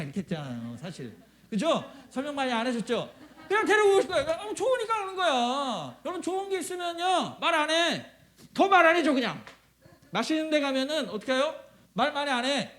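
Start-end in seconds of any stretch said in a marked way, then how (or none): 3.5-9.4 high, raised, excited pulpit voice; lively, insistent preacher's delivery; animated preaching. Real, not acted. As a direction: push each point with rising energy. Preaches at full force.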